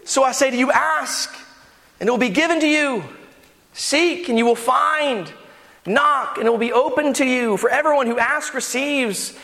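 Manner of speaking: high, emphatic scripture reading; impassioned preaching. Acted. Preacher correcting the joke with the real verse, impassioned. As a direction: ring out each promise and question.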